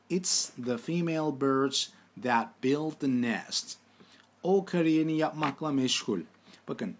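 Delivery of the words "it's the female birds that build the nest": slow, careful repeat of the sentence; steady dictation pace. Shown as a demonstration. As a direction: read it again slowly for the class. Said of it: "Tekrar edelim".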